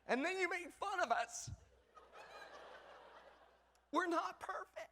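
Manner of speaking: high, whining protest through laughter; high whiny laughing complaint. Acted. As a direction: plead like a wounded dad. Mock-whiny complaint as a dad.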